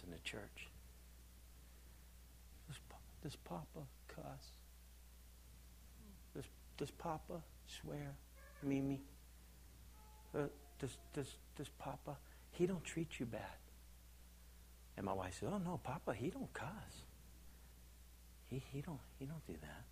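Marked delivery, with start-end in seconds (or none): 2.7-13.6 very soft, halting near-whisper; hushed child's question. Retold or imitated. Grandbabies ask Mimi about Papa, hushed and hesitant. 15.5-19.8 very soft, halting denial; hushed reassurance. Retold or imitated. Wife answers grandbabies about Papa, softly denying it.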